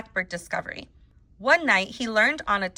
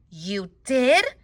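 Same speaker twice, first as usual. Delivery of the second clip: surprised question; 3 semitones higher than usual. A narrator's reading. Girl hearing the boy's confession, surprised question. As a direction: pitch sweeping up in disbelief.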